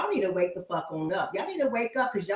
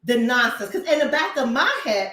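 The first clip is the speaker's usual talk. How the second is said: ordinary delivery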